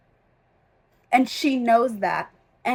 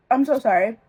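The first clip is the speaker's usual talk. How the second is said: ordinary talk, nothing marked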